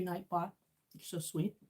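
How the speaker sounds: ordinary delivery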